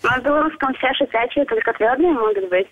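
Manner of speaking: ordinary talk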